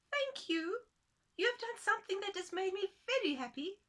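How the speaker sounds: a delighted little creature; grateful tiny man. Acted. Tiny man thanking the woman, very high and happy. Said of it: "said the tiny man".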